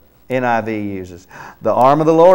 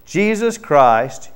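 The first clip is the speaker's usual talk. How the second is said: ordinary delivery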